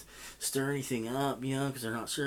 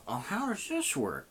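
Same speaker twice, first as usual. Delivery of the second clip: higher put-on voice, mock puzzled; feigned cluelessness; 5 semitones higher than usual. Old man trying the same act on more kids, feigning ignorance. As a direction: imitate someone playing dumb. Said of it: "he does it again".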